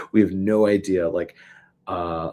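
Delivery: normal talking voice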